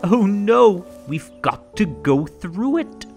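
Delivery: ordinary delivery, nothing marked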